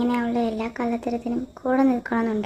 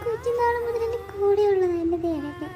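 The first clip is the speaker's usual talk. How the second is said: higher, tearful pleading; crying; 7 semitones higher than usual. Acted. A girl telling her friend through tears why she hit the rival. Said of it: "നീ ഇങ്ങനെ കരയല്ലേ".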